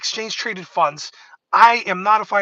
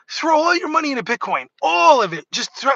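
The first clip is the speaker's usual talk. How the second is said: high mock sales pitch to retail; mocking hype; 7 semitones higher, 3 dB louder than usual. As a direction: ham up a hype man urging all in. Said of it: "Telling retail".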